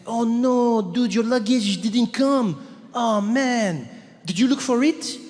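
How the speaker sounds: cool French guy. Retold or imitated. French clerk hearing of lost luggage, dismayed French accent. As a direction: commiserate like a French dude, drawn out. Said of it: "but very French, very French, cool guy".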